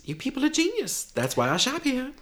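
high, gushing voice; gushing praise. Acted. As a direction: gush over them. Gushing shopper.

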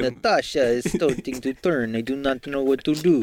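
a hammed-up bad Russian accent, slow and heavy. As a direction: play a cheesy Russian game character in a bad Russian accent. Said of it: "really bad Russian accents".

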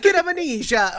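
very high, hammed-up Italian accent; mock Italian accent. Host parrots the Italian title back, mock Italian accent. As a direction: throw it out like an excitable stage Italian. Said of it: "it's the Italian version".

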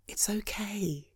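slow, whispered it's okay; soft reassurance. Acted. Husband comforting his exhausted wife, slow soft whisper. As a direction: breathe it out slowly to comfort her.

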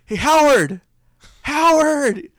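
high, loud holler of a name; yelled-out call. Retold or imitated. Cast member yelling across the red carpet, loud call. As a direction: call out loudly across a crowd. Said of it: "he yells out".

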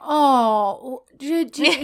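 cute mock-sympathetic lilt; patronizing. Man humors drunk woman's complaints, playfully patronizing. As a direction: lightly humor them with a rising question. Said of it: "he's kind of being patronizing".

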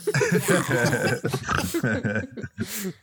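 drawn-out zombie groan; zombie noise. Pretend. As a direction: moan like a hungry undead. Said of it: "I'll do the zombie noise".

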